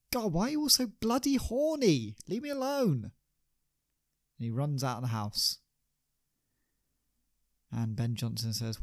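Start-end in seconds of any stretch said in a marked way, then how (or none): 0.1-3.1 high, fed-up outburst; exasperated young actor. Acted. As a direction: throw it back at them, pitched high and annoyed. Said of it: "And the young actor said".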